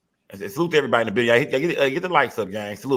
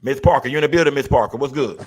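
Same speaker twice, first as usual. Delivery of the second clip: loud, higher-pitched call to a caller; 3 semitones higher, 6 dB louder than usual. Real, not acted. Host checking whether the caller is on the line, calling out. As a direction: call out to get the caller's attention.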